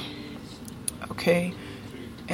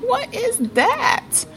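high, slow incredulous "what is that"; stunned disbelief, drawn out; baffled; much slower than usual. Real, not acted. Incredulous reaction to message.

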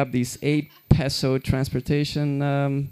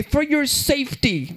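high, slow mock clerk voice; bossy store clerk; 11 semitones higher than usual. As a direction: drag it out smugly.

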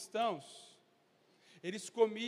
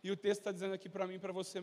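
ordinary delivery

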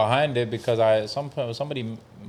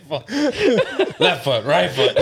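laughing; 5 semitones higher, 4 dB louder than usual. Acted. Imitating exhausted workers.

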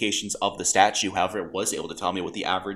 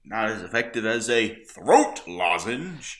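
mock pompous voice; slower than usual. Insisting to co-host about lozenges, mock pompous. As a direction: pronounce it like a smug expert.

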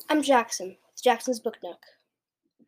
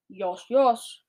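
a giant's lightly boomed retort; mild boom. A narrator's reading. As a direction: boom it with some restraint. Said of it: "boomed the blood bottler".

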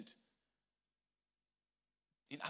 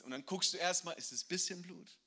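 usual speaking voice

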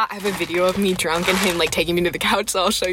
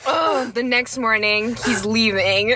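normal talking voice

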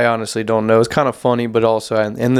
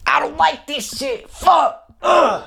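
very high, squealed whine; hammed-up squealing frustration, ending in a groan; mock tantrum; 14 semitones higher, slower than usual. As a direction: whine it in an exaggerated high-pitched fit. Mock tantrum over missing the list.

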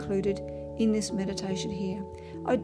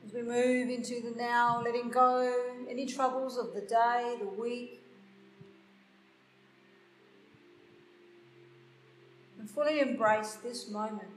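guided meditation; 8 dB quieter than usual. Acted. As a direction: guide them gently into stillness. Leads a meditation.

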